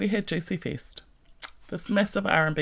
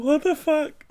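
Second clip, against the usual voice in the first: high, louder cry of disbelief; incredulous outburst; 12 semitones higher, 5 dB louder, slower than usual. Retold or imitated. Client retelling her horror at stained eyebrows, incredulous. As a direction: blurt it out in mock panic.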